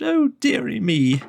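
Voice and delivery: hammed-up sobbing king; mock sob. A narrator's reading. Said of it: "The King sobbed".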